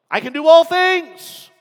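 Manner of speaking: high, loud mock of someone stressing all; mocking misreader. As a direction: parody the ones who love the all things part. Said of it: "They love the all things part".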